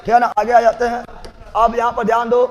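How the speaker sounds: ordinary delivery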